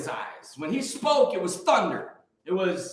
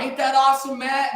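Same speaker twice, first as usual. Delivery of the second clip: imitating a pastor's excited cheer, a bit higher and louder; fired-up retelling; 7 semitones higher, 3 dB louder than usual. Pastor cheering about seven hundred baptisms, excited. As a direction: brag it up like the room is celebrating. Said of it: "he's fired up about it".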